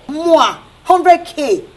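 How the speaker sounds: scornful. Acted. Scoffing at insulting offer.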